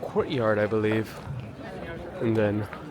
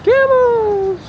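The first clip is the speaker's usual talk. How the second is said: hyped reveal; 8 dB louder than usual. Real, not acted. Excited hype for the camels.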